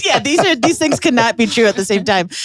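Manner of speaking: high, lightly hammed-up flustered young man; mock flustered clerk. Retelling the cashier's flustered reply in a high mock voice. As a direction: play the clerk scrambling for an excuse. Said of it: "And he's like".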